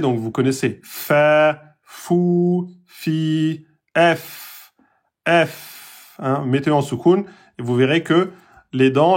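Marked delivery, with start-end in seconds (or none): 0.8-6.1 démonstration du son F; demo of fa, fou, fi. Shown as a demonstration. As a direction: clear letter-sound demo, "F" teeth on lip. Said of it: "C'est la lettre F en français".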